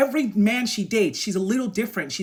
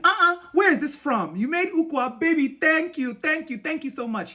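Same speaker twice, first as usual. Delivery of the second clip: put-on high-pitched, thrilled boyfriend; delighted gratitude; 8 semitones higher than usual. A narrator's reading. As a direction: gush with surprised thanks. Reading Chuka's delighted reaction.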